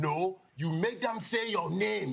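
slow, high, emphatic father; deliberate, insistent dad telling his son; firm father voice. Retold or imitated. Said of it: "I remember my dad coming home".

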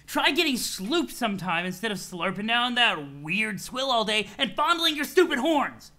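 irritable troll, berating. Acted. Troll scolds friend over his drinking habit, loud and irritable. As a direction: tell him off in acted disgust.

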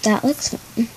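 ordinary delivery

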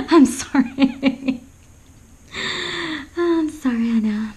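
slow, giggling sorry; laughing apology. Real, not acted. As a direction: apologise while still laughing. Laughing apology after crude joke.

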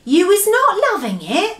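high-pitched innocent question; innocent asking. A narrator's reading. BFG asks the furious giant, innocently. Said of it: "the B F G asked innocently".

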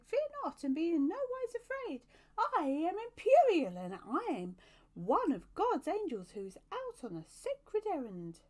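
very high, loud cherub announcement; loud proclamation. A narrator's reading. Cherub announcing himself to a man by the gate, proclaiming. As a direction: proclaim it at a high, excited pitch, full voice.

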